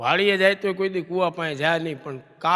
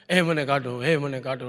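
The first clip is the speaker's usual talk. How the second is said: usual speaking voice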